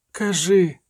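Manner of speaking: plain reading voice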